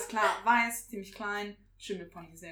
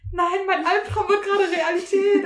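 mock panic; 8 semitones higher, 9 dB louder, slower than usual. Retold panic during the exam.

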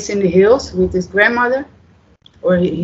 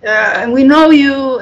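fan gushing; 7 semitones higher, 4 dB louder than usual. Retold or imitated. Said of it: "Everybody on the street was like".

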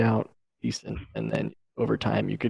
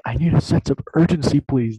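urgent raised voice, pitched up; loud demanding; 5 semitones higher, 9 dB louder than usual. Retold or imitated. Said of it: "pop off".

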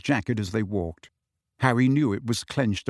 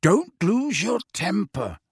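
higher, slow and emphatic warning; Sirius, abrupt; 8 semitones higher, slower than usual. A narrator's reading. Sirius advises Harry before the hearing, mildly abrupt. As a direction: deliver a mildly abrupt order, weighting each word. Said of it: "said Sirius abruptly".

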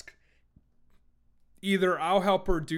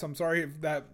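usual speaking voice

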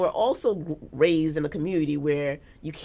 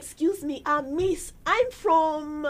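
put-on high, slow German accent; a lightly done German tourist asking directions; German-accented English; 8 semitones higher, slower than usual. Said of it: "What a something accent".

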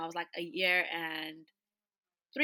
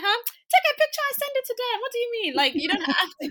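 much higher mock doctor voice, amused disbelief; incredulous doctor; 9 dB louder than usual. Doctor scoffing at the patient, high amused disbelief. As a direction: play the doctor scoffing at the silly question.

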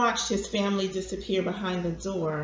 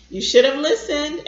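loud, high-pitched reproach; scolding; 6 semitones higher, 8 dB louder than usual. A narrator's reading. Grandson chiding sick grandfather for not listening, scolding. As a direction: tell him off firmly. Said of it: "he shook his finger at John".

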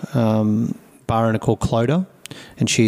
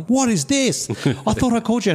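much higher mock owner voice; imagined angry owner; 12 semitones higher than usual. Brewer imagining the bar owner's complaint, high mock voice. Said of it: "this call from her going".

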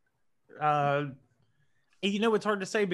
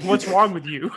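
loud, raised-pitch imitation of appalled listeners; mock indignation; 4 semitones higher, 7 dB louder than usual. Listeners reacting to his French, mock outraged.

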